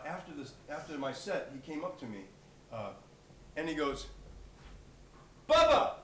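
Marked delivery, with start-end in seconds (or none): 5.5-5.9 calling out. Retold or imitated. Old man hails the singer after his set, calling out. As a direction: holler a nickname in delighted recognition.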